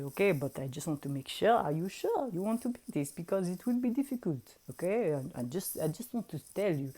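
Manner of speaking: put-on quick accented wizard voice; fast, accented impression of a careful adviser; imitated wizard. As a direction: caution them in an imitated accent. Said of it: "And, and then Alexandra, I say".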